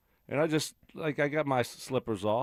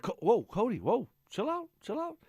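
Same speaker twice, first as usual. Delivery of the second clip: calming the dog; 12 semitones higher than usual. Retold or imitated. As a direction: ease the dog down, going up. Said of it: "I'm like".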